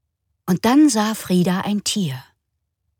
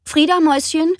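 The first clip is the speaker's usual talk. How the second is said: higher, louder mother's call; calling from kitchen; 6 semitones higher, 4 dB louder than usual. Acted. Mama calling Frieda from the kitchen, louder and higher. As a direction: call out across the house. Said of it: "rief Mama aus der Küche".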